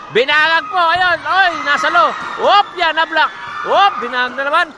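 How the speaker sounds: loud, high shouts of a rally; shouting play-by-play. Real, not acted. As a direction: yell every hit. Excited volleyball play-by-play.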